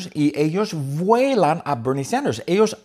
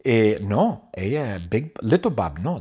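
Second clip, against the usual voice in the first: ordinary delivery, nothing marked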